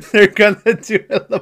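high, rushed words through laughter; real giggling fit while talking; cracking up. Real, not acted. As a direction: laugh helplessly at your own stumble. Cracks up at own stumble.

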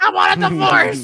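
high, loud bride demanding divorce; hammed-up furious bride; mock outrage. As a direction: shout it high, playing offended.

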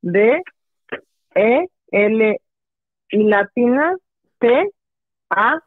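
letra por letra, con pausas; deletreando. Shown as a demonstration. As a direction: deletrear despacio y claro. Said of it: "A ver, deletréalo". (deletreando su correo)

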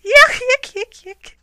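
very high, goofy yuk-yuk cackle; loud, hammed-up joke laugh; high-pitched corny chortle. A narrator's reading. Bear laughs at own joke.

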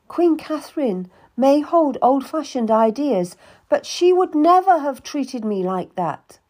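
high, loud wounded outburst; tearful anger. Acted. Cat accuses Anne of trying to buy her, tearful and angry. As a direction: pour out the betrayal.

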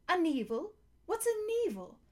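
high-pitched, bewildered animal voice; puzzled creature. A narrator's reading. Talking beasts puzzling over a new word, high and baffled. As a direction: squeak the question in confusion.

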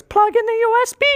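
brain's voice. Retold or imitated. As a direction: command it from way up in the voice. Brain gives order.

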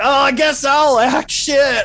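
loud, high, slow mock-dumb line; mock doofus voice. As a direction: ham up a clueless guy deciding to act. Mocking a co-star's modesty.

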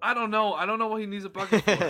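laughing loudly through the words; high, loud, laughing disbelief. Real, not acted. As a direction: burst out laughing as you say it. Laughing while retelling bee chaos.

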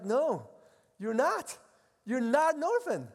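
God promising to hear every cry; voicing God reassuringly. Preacher voicing God to an anxious believer, earnest reassurance.

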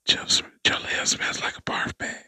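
sneaky teasing whisper. Pretend. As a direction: whisper a playful insult.

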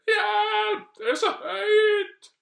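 long, very high, loud wail; hammed-up screaming queen; Dronning Sonja, scared of heights. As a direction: shriek in mock panic, dangling in the air. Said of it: "hun hadde høydeskrekk".

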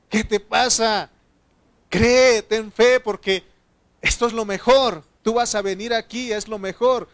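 loud, high exhortation from the dead; forceful, high-pitched pleading with the mourner; impassioned rebuke. Acted. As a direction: raise the voice and urge them to believe. The dead scold their mourners.

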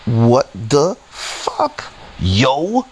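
"What the fuck" stretched word by word, rising; very slow "What the fuck", lifting into a questioning "yo"; drawn-out disbelief. Real, not acted. Storyteller underlining disbelief, "What the fuck" drawn out slowly. Repeats his disbelief for emphasis.

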